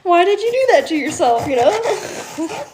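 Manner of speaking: high, loud laughing question; laughing disbelief. Real, not acted. Vlogger laughing at doubtful reviewers, high and loud.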